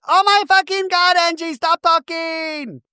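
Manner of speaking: very high, drawn-out shriek; mock screaming. Recapping Whitney finally snapping at Angie, mock screaming. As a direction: imitate an exasperated castmate screeching at full stretch. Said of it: "has to scream like Meredith".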